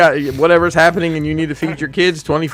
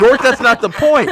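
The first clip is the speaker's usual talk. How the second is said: higher, laughing through the words; laughing protest; 9 semitones higher, 4 dB louder than usual. Real, not acted. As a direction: protest while cracking up. Laughing at friend's silly offer.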